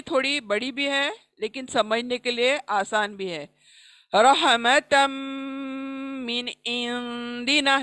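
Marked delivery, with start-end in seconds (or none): 4.1-7.8 slow melodic tajweed recitation of रहमतम मिन इंदिना with nasal नून; tajweed demo; Quranic recitation. Shown as a demonstration. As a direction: chant it slowly, hiding the नून in the nose. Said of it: "देखें".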